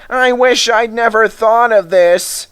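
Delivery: high, loud regretful moan; the crushed van complaining; groaning van. Acted. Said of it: "groaned the van".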